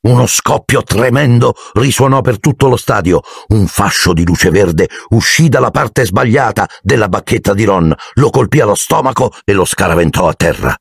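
usual speaking voice

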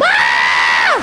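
loud, long mock scream; loud hammed-up scream of delirious joy; comic scream. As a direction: let out a sustained joke scream. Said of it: "your vaginas would scream".

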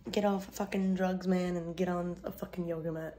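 usual speaking voice

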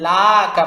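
"লাকা" with "লা" held long; wrongly stretched vowel. Shown as a demonstration. Teacher explaining the error, "লাকা" with elongated "লা". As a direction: pull the first vowel of "লাকা" out, then drop "কা". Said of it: "লাতে একটা টান দিয়ে দেন".

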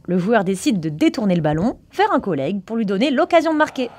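ordinary talk

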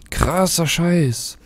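slow, drawn-out awed exclamation. Real, not acted. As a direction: let it sink in, slowly. Awed after the mission ends.

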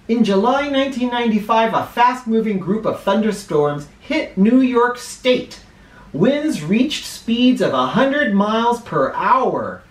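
clear, measured read-aloud for a class; reading to children. Acted. Teacher reading a weather book to her class, read-aloud style.

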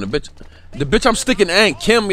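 normal talking voice

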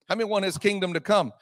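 ordinary delivery

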